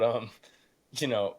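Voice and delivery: ordinary delivery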